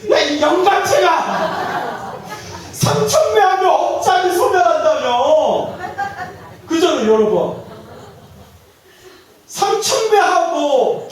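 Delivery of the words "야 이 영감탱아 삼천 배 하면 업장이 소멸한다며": high, slow, laughing mock yell at the old monk; hammed-up outburst, half laughing; mock furious yell. Old laywoman yells at the head monk, mock fury.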